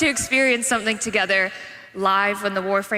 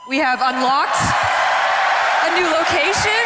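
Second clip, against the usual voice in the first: projected, climbing toward the reveal; hyped announcement; 7 semitones higher, 4 dB louder than usual. Real, not acted. Host revealing a new location, excited and rising. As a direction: build it up for a cheering crowd.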